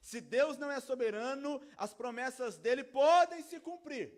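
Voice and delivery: loud, high, emphatic preacher; impassioned pulpit proclamation; full-force preaching. Real, not acted. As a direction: preach it at full volume, ringing out.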